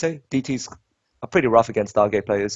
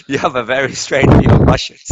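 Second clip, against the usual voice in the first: louder mock Russian accent; hammy Russian accent, louder; 3 semitones higher, 7 dB louder than usual. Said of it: "I need to do Russian accent".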